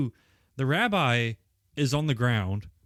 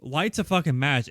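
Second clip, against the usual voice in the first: ordinary talk, nothing marked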